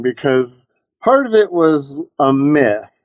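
ordinary talk

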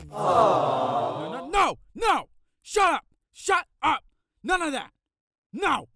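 furious screaming, very loud and high; angry shouting. Acted. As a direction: yell it down in a rage. Yelling at jaw pain flashback.

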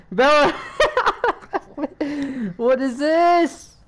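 high-pitched cry broken by laughter; cracking up in utter bewilderment; laughing disbelief. Real, not acted. Laughing disbelief at game chaos.

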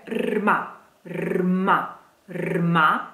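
"rma" with a trilled R straight into M; R into M drill. Shown as a demonstration. Coach drilling "rma" for singers. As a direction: drill "rma", tapping R then M. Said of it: "So practice the R".

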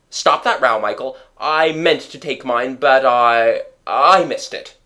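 loud, self-justifying father; blustering excuse. A narrator's reading. Father scolds Michael and excuses himself, blustering. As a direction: bluster loudly through a feeble excuse.

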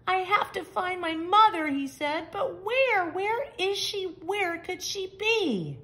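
high, anxious little bird voice; worried baby bird. A narrator's reading. Lost baby bird asks himself, high and worried. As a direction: wonder aloud where mother is.

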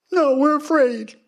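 high, slow acted reluctance; mock fearful refusal. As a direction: balk like the scared crowd, lightly hammed-up. The fearful people answer Caleb.

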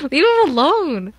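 slow, exasperated leave him alone; mock plea. Real, not acted. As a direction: drag it out, exasperated. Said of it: "reaction commentary".

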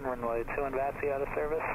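usual speaking voice

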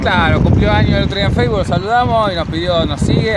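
loud and fast over wind; shouting over wind. Real, not acted. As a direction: shout it quickly over the gusts. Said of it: "está terrible el viento".

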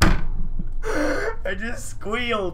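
high, squeaky words through laughter; helpless laughter. Real, not acted. Player cracking up at own squeal, laughing hard. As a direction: try to talk while cracking up.

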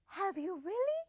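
high, hesitant question; doubtful mother duck. A narrator's reading. Missus Duck behind the cracked door asking, high and doubtful. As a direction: ask it in a high, hopeful duck voice. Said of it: "asked Missus Duck".

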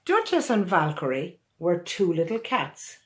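usual speaking voice

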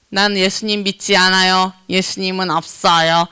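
low, dismissive character voice; unbeliever's denial. Acted. Acting someone who denies Jesus. (낮고 무심하게 부정하듯)

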